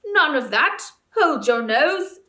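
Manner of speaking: loud slow snarling ape. A narrator's reading. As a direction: snarl the order down at them. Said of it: "said the ape with a snarl".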